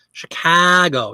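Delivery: "Chicago" drawn out slowly on a broad flattened A; slow, exaggerated Midwestern "Chicago"; lazy Chicago A. Shown as a demonstration. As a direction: stretch the middle A of "Chicago", Midwest style. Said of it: "Yep, that lazy A".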